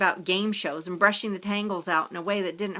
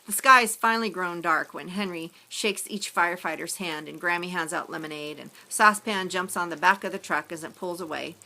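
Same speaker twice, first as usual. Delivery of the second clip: ordinary delivery, nothing marked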